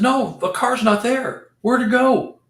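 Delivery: high, slow, bewildered insistence; disbelieving alarm. Retold or imitated. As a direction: sound truly baffled the car moved. Panicked about car.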